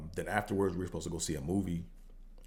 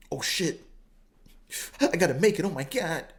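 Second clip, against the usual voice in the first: higher, louder panicked hurry; frantic rush; 8 semitones higher, 4 dB louder than usual. Retold or imitated. Him reliving racing to get there in five minutes. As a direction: race the clock in a panic. Rushing to arrive in time.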